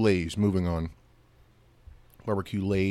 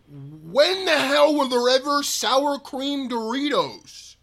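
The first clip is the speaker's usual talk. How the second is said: loud, high, slow disbelief; baffled outburst; 13 semitones higher, 4 dB louder than usual. Real, not acted. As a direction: cry out in total confusion. Baffled by sour cream Doritos.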